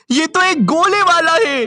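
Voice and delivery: high, drawn-out mock excitement; excited discovery. Kid spots the ice-gola seller, excited high cry. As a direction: call it out high and thrilled.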